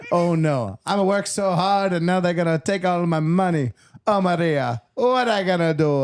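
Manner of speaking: put-on comic Italian farmer accent, despairing. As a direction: ham up a broken-English Italian papa. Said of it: "oh, he's Italian".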